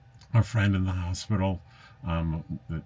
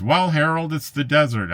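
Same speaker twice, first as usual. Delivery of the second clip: high, louder, playfully sarcastic quote; mock-exasperated question and answer, pitched up; a little sarcastic; 10 semitones higher, 7 dB louder than usual. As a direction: read it out high and loud with light sarcasm. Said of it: "I was being a little sarcastic".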